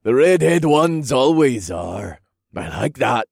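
put-on high, drawn-out drunken whoop, then slow slurring; slurred, taunting come-on; leering drunk. A narrator's reading. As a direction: hoot and drawl like a rowdy drunk. Drunk man leers at redhead.

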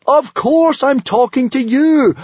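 high, drawn-out exasperated howl. A narrator's reading. Hiccup answers the teasing dragon, howling. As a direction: howl every word in desperation. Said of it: "howled Hiccup".

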